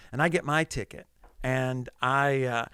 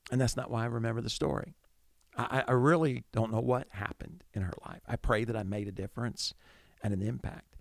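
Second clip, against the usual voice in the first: ordinary talk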